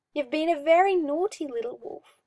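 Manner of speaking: lightly voiced magister, chiding with mock sweetness; a touch of a sinister taunt. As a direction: scold him like a naughty pet. Magister scolding pet.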